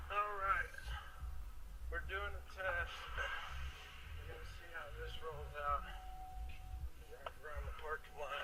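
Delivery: muffled, distant inside a car. Real, not acted. Recorded test clip replayed.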